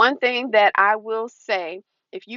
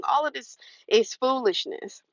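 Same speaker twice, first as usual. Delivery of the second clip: ordinary talk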